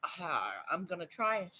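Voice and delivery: soft, hesitant self-talk; hushed inner voice. Retold or imitated. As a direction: murmur it to yourself, half-decided. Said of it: "but I'm like".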